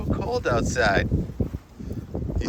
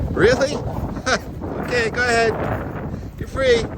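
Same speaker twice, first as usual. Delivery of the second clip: much higher, bright coaxing pet talk; 12 semitones higher than usual. Real, not acted. Owner letting dog off to the water, high-pitched pet talk.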